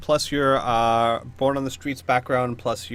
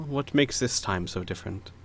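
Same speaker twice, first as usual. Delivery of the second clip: low, softer, serious noblewoman; Lady Trask; 4 semitones lower, 6 dB quieter than usual. Acted. Baroness asks what makes this threat different, quiet seriousness. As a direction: quietly press for the reason. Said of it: "and she says".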